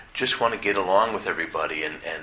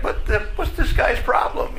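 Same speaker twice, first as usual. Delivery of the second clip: much higher, half-laughing airy what-the; mock baffled outrage; 4 dB louder than usual. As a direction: play the stunned parishioners, half-laughing. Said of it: "they're like".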